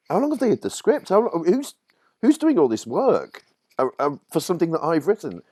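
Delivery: very high, incredulous questions; baffled disbelief. Retold or imitated. Writer amazed at crews working on his script, high and incredulous. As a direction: ask it in stunned disbelief. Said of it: "And you're like".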